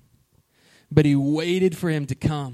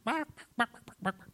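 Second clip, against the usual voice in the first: chicken clucking; 5 semitones higher, 14 dB quieter than usual. Retold or imitated. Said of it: "chickens balking around".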